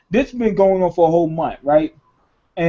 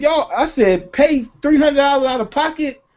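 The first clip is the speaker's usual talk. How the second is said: much higher, incredulous cry; retold outrage; 8 semitones higher than usual. A student retelling his shock at the school's fee, very high and incredulous. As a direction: throw it up high in disbelief.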